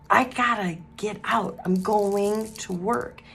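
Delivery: slow, drawn-out words to the roofers; slow calling out. Retold or imitated. To the roofers on top of the roof, slow drawn-out calling. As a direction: spell it out slowly so they get it. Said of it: "they didn't understand what I was saying".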